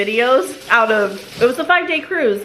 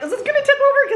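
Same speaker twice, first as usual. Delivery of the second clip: mock worried; 13 semitones higher, faster than usual. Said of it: "I was like".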